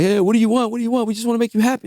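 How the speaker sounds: high, sugary imitation of label guys; lightly hammed-up eager-to-please bosses; mock eager executives. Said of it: "They're like".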